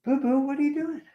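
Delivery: high pet voice. Real, not acted. Owner asks the cat what it's doing, baby talk.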